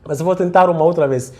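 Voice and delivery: usual speaking voice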